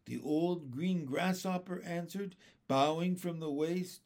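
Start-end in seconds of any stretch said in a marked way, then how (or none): none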